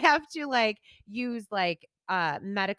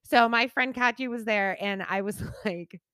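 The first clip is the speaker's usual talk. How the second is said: normal talking voice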